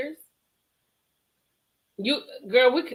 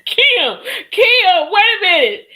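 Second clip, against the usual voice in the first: high, loud laughing shout of the name; 9 semitones higher, 10 dB louder, slower than usual. Real, not acted. Host laughing loudly, calling to Kim mid-interview. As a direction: burst out laughing and call out to her. Cracking up at a guest's claim.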